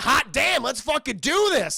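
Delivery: loud, high mock jubilation; gleeful excitement. Jeff hears Mabel will marry him, loudly thrilled. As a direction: whoop it like an eager groom.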